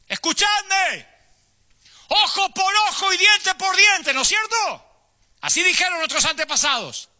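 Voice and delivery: Jesus preaching on the mountain. Acted. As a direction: project it to the last person on the hillside. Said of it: "fuerte para que lo escuchara el último". (Jesús predicando a gritos en la montaña)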